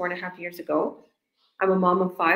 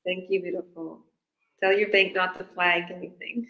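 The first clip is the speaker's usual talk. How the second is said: ordinary delivery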